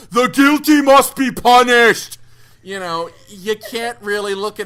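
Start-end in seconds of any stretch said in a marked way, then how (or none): 0.1-2.2 put-on thick-accented shout, slow and booming; mock action-hero roar with a heavy accent; Dolph Lundgren. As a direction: bellow it slowly in a thick accent. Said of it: "shout in his thick accent".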